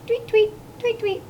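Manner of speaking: high little bird tweets; chittering starlings. A narrator's reading. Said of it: "The starlings chittered".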